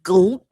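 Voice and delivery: comic cartoon gulp noise; exaggerated cartoon gulp. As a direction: swallow loudly like a cartoon. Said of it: "the full, like, cartoon".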